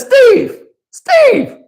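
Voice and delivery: put-on high child's voice calling out a name; little girl calling. Daughter calling from the swing.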